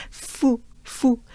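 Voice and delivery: fou said closed, tight and dry; teacher shows a tight closed fou. Shown as a demonstration. Teacher models closed dry fou for learners. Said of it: "very closed up and kind of dry".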